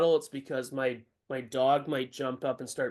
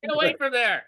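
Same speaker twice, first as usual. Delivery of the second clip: yelling at dog; 10 semitones higher, 3 dB louder than usual. Real, not acted.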